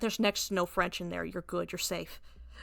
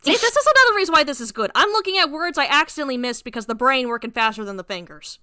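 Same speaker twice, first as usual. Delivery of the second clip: excited fast aside; 3 semitones higher, 8 dB louder, faster than usual. Real, not acted. Host excitedly explains to listeners why reading aloud helps. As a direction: rush it out in a burst of enthusiasm.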